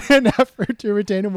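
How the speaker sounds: amused, high and loud through a laugh; laugh, then repeat it loudly; laughing as the words come out. Real, not acted. Laughs while repeating co-host's quip.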